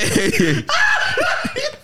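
high screaming burst of laughter; shrieking laugh. Real, not acted. Friend cracks up at the Scottish joke, shrieking laugh. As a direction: howl with laughter.

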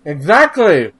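talking while eating a burger; mouth full. Real, not acted. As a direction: say it through a bite of burger. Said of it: "I'm sorry, I'm eating a burger".

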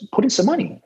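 mock-exasperated sponsor pressing for money; insistent repeat. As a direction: push it up, losing patience.

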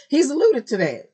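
high, loud excited repeat; excited emphasis. Real, not acted. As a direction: stress alluded, thrilled. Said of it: "repeats with emphasis".

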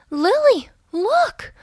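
high, excited cry to a friend; excited exclamation. A narrator's reading. Buffy calls excitedly to Lily about the sky. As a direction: point and call out in excitement. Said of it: "Buffy exclaimed".

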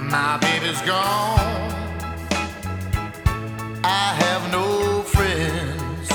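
ordinary talk

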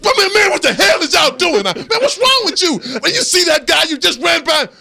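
loud high shout; furious yelling. Retold or imitated. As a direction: scream at the lineman who missed the block. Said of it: "like I was like".

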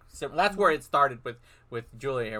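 usual speaking voice

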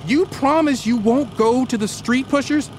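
pleading promise. Acted. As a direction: coax a promise out of him. Anslinger gives in.

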